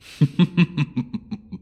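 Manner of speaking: high, loud mocking cackle; evil dragon laugh. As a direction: burst into a cruel triumphant laugh. Dragon refuses to free thief.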